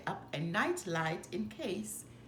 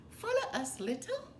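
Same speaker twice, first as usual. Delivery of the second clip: high, bright Piglet call with a giggle; giggly Piglet; 10 semitones higher than usual. Acted. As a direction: squeak the invitation playfully. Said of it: "said Piglet with a giggle".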